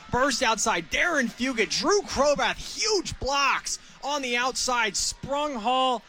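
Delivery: excited analysis. Real, not acted. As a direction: talk it up, still buzzing from the play. Analyst relives the big run.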